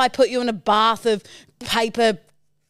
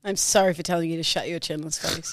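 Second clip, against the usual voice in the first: lower, softened sorry; subdued apology; 7 semitones lower, 7 dB quieter than usual. Real, not acted. Woman apologises to partner for an insult, subdued. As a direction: bring the voice right down to apologise. Returns apology after the row.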